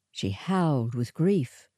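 ordinary delivery

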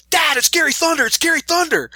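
put-on high, thrilled child calling out to dad; excited kid. Little boy at Best Buy spots the wrestler, shouting excitedly to his dad. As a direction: squeal it like a kid spotting his hero.

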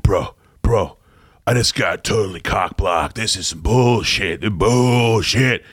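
low, hushed bro voice; lightly voiced annoyed bro; frat bro. Reading friend's texts as bro.

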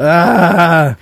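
loud, high "ah" of overload; frustrated groan. Real, not acted. Muddled over which film is which, frustrated groan. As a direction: let out a long high groan.

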